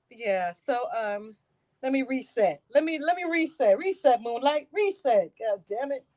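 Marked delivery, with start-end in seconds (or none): none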